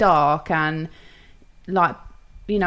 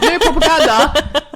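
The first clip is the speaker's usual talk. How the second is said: mock grand; 5 semitones higher than usual. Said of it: "love the word propaganda".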